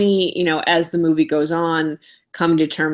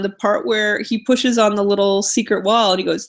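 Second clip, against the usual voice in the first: ordinary talk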